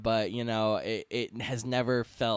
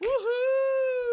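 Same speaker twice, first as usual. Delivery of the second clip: very high whoop; cheering whoop. Real, not acted. Co-host celebrating the new podcast name, high whoop. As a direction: cheer with delight.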